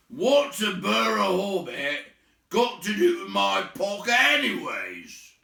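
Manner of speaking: high, loud, slow troll grumble; suspicious troll. Acted. William suspicious about his pocket, grumbling troll. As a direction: demand it suspiciously.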